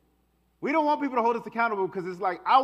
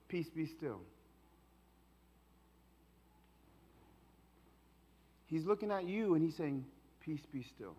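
much softer, calming words; soft reassurance; 6 semitones lower, 12 dB quieter than usual. Acted. Jesus looking at the frightened disciples, soft reassurance. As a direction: hush the fear gently.

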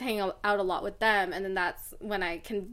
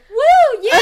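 high, loud party whoop; obnoxious whoop; 14 semitones higher, 13 dB louder than usual. Retold or imitated. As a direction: whoop like the loud girl in class. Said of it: "the obnoxious Vietnamese girl, going".

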